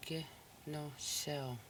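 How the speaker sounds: Kinusel said slowly with long syllables; slow teaching pronunciation; slow, drawn out. Shown as a demonstration. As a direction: stretch Kinusel out for the learners.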